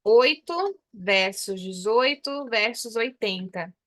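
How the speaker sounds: slow, spaced-out "oito versus dezoito versus oitenta"; slow teacher contrast of the numbers. Shown as a demonstration. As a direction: lay the three numbers side by side slowly. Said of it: "Ó".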